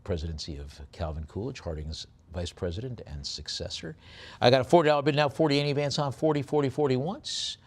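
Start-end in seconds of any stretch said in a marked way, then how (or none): 4.4-7.6 quick, punchy bid calling, pitch jumping up on once and twice; auctioneer's call. Acted. Auctioneer calling bids to the online bidders, brisk and rhythmic. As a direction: rattle off the bids like a live auctioneer. Calls bids on coin lot.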